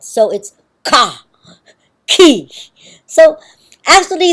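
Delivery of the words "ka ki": ka and ki with a firm, slightly aspirated K, slowly; forceful Tagalog K. Shown as a demonstration. Teacher demonstrating the Tagalog K to students, slow and forceful. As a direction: lean into the K of ka and ki with a little extra force. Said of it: "the Tagalog K is subtly aspirated".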